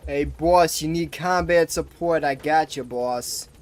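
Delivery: loud, hammed-up New York accent; bad New York accent. Streamer offering boss combat support in a loud, hammy New York accent. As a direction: call out to the boss like a New Yorker. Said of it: "my New York accent is fucking terrible".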